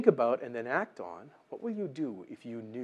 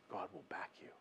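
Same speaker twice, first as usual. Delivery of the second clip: whisper; 8 semitones lower, 24 dB quieter, faster than usual. Real, not acted. Preacher drives home key line.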